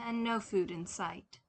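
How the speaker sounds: plain reading voice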